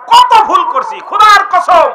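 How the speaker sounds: high, loud avowal; impassioned, pitched well up; emphatic exclamation. Acted. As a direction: cry it out as an oath. Preacher voices Anas swearing emphatically.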